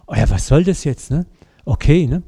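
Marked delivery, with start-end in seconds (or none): none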